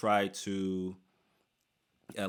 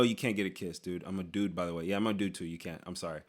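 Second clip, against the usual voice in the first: ordinary talk, nothing marked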